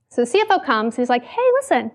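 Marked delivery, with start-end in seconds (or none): 1.2-1.9 high, sweetly polite boss voice; really polite. Retold or imitated. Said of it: "really, really polite".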